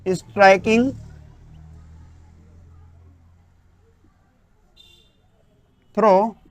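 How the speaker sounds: very slow, separated words for note-taking; word-by-word dictation. Acted. Teacher dictating the English definition, very slow and spaced. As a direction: dictate each word with long gaps.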